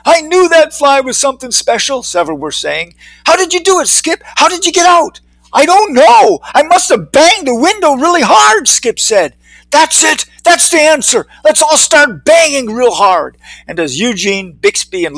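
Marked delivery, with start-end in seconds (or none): 0.0-2.0 high-pitched, impressed chatter; excited admiration. Acted. Cheering crowd admires Skip. 3.2-5.2 very high, eager questions; excited questioning. Acted. Excited crowd questions the escapee. 5.5-8.7 high, loud, amazed reply; excited answer. Acted. Skip answers the cheering crowd. 9.7-13.4 high-pitched eureka cries; rally the crowd to action; excited rallying. Acted. Crowd seizes on the answer.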